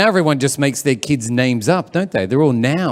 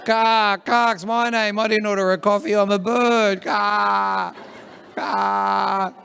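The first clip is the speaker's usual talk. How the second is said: high squawking talking crow; 6 semitones higher, much slower than usual. Acted. Circling crow answering the coffee call, squawking. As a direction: caw the words like a bird. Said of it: "crows are circling".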